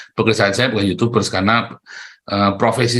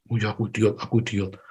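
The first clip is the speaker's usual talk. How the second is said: meek agreement; 3 semitones lower, 9 dB quieter than usual. Retold or imitated. Voicing meek obedient self.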